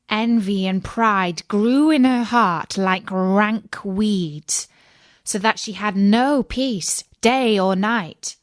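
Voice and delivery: plain reading voice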